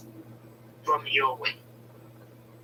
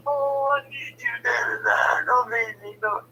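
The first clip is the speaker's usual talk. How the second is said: mock pleading; 8 semitones higher, slower than usual. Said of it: "So she's like".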